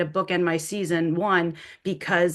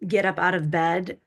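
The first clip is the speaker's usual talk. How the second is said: normal talking voice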